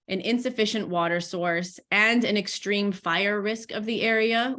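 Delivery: usual speaking voice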